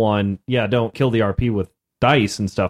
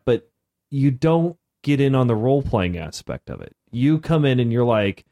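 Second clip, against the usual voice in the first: ordinary talk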